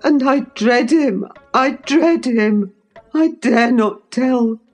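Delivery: put-on high, fearful boy's voice; gasping dread. A narrator's reading. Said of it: "gasped the boy".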